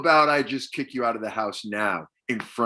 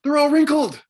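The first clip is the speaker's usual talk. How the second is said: 1 upset outburst; 12 semitones higher, 3 dB louder than usual. Acted. Mother upset over wrinkled coats.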